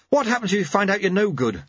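worried apprentice; alarmed young monk. Acted. Lobsang voiced.

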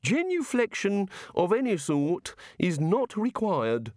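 hint of a curious, prim deity; the god. A narrator's reading. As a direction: play the fussy island god, measured. God character voiced in reading.

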